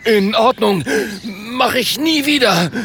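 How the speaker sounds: hoch und ängstlich kleinlaut; zitternd. Acted. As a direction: versprich es mit hoher, zitternder Stimme. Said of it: "Zitternd antwortet der Ritter". (zitternd vor Angst, sehr hoch)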